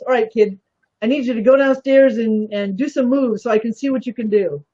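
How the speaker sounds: ordinary delivery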